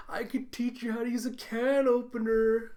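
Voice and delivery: high, loud chirpy offer; mock cheerful. Coworker offers a can-opener lesson, exaggeratedly cheerful. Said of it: "Cheerfy's like".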